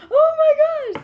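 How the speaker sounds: very high, loud squeal; overjoyed high-pitched shriek; squealing joy. Retold or imitated. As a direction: shriek it like a thrilled teen. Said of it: "And she's like".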